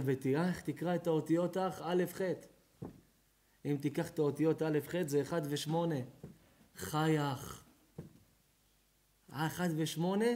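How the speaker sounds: normal talking voice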